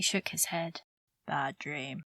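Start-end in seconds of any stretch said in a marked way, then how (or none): 1.3-2.0 short, low and soft; curt. A narrator's reading. Injured man hiding his nightmare from her, curt. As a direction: brush it off in a low voice. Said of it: "he said shortly".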